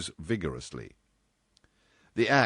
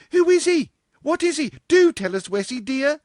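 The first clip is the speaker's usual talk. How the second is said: much higher and much louder, breathless eagerness; excited; 9 dB louder than usual. Acted. Marjorie begging Dorothy for gossip, excited high cry. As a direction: pester her for gossip, thrilled. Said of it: "cried Marjorie Rogers excitedly".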